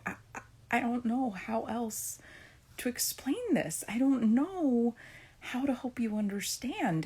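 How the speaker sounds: stuck in a loop, pleading to be understood; retold frustration. Mother retelling being stuck explaining language arts, exasperated. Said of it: "I'm getting frustrated".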